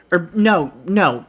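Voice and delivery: normal talking voice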